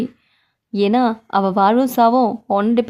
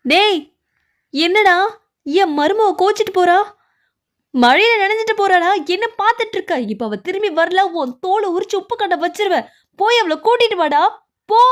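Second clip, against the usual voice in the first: angry scolding; 5 semitones higher than usual. Acted. Father-in-law yells at son to bring back wife, furious. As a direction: roar at him to fetch her back.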